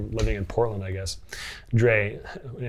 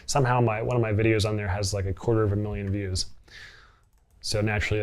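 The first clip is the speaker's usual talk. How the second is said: usual speaking voice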